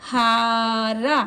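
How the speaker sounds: हारा very slowly with both आ long; drawn out word. Shown as a demonstration. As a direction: stretch हारा out. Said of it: "उसको थोड़ा देर तक बोलेंगे".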